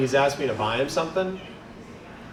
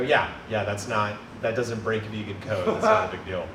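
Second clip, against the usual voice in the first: low, soft, offhand reply; imitating unconvinced coworkers, quiet and low; no conviction; 8 semitones lower, 7 dB quieter than usual. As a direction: shrug it off half-heartedly. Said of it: "With no conviction".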